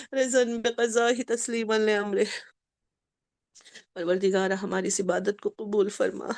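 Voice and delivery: low, soft, pleading supplication; closing dua of the majlis; prayer. Real, not acted. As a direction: pray quietly for the congregation.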